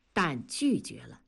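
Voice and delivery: ordinary delivery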